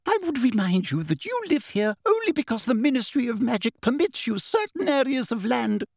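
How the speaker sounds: high, indignant official lecture; scared, affronted bureaucrat; fright and indignation. A narrator's reading. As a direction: remind them haughtily in a high voice. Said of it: "not only with fright, but also".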